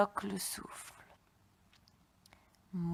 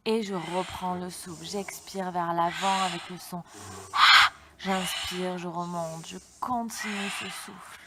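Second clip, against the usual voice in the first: yoga instructor; 5 dB louder than usual. Acted. Yoga breathing class instructions.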